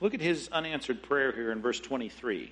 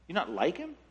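ordinary talk, nothing marked

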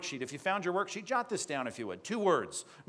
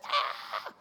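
very high imitated squeal; mock fangirl scream. Preacher imitating daughters shouting for Hannah Montana, high scream. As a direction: squeal like an overexcited preteen fan. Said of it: "They are shouting".

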